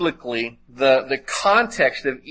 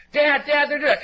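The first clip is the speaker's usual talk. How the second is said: much higher voice calling to Dad; tattling sibling; 12 semitones higher, faster than usual. Retold or imitated. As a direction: snitch on the sibling in a high kid's voice. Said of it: "just say".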